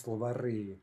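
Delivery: slovari with stress on the final i; stress on the ending. Shown as a demonstration. Teacher showing the plural, slovari stressed on the ending. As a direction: slowly land on the last vowel of slovari. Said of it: "it's gonna be stressed".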